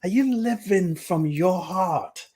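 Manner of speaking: rising preacher-like urging; impassioned plea. Real, not acted. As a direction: plead with them to face the question. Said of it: "Let's make it simple".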